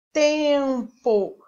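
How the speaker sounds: TEMPO stretched, long nasal "tem", then "po"; sounding out syllables. Shown as a demonstration. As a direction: say "tempo" in two loud, separate syllables. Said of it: "note que você pronuncia". ("tempo" silabado, com o "tem" prolongado)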